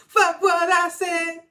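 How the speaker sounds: high, slow, loud laughing imitation; laughing mock quote. Retelling Will's line to the listeners, laughing high and slow. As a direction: crack up while quoting him.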